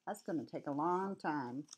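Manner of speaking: usual speaking voice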